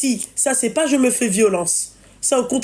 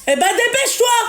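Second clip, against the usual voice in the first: calling out; 11 semitones higher than usual. Real, not acted. Scolding daughter across the house.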